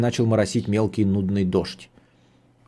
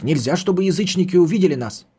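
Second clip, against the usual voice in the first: high-pitched, anxious-to-go warning; urgent hurrying; 7 semitones higher than usual. A narrator's reading. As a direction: warn them urgently, pitch lifted throughout. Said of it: "торопил нас старик".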